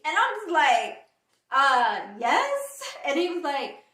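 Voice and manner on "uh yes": surprised. Retold or imitated. Surprised reply to being called.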